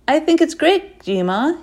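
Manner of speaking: loud, high fake cheer; overly bright, hiding his real feelings; forced enthusiasm. A narrator's reading. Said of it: "he says with forced enthusiasm".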